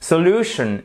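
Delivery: "solution" avec appui net sur LU, puis chute; accent tonique juste. Shown as a demonstration. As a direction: fais ressortir le LU de "solution". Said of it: "sur la deuxième syllabe". ("solution" à l'anglaise, so-LU-tion)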